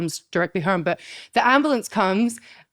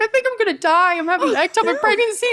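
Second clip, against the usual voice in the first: high, frantic phone call replayed; retold panic; 13 semitones higher than usual. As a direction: act out breaking terrible news in alarm. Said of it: "I'm, like, freaking out".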